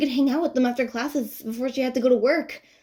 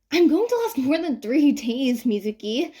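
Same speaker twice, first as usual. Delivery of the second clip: retort; 4 semitones higher, 4 dB louder than usual. Acted. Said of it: "Ann retorts".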